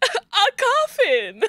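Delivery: a gleefully morbid character answer; gleeful ghoul. Acted. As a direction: relish the morbid reply.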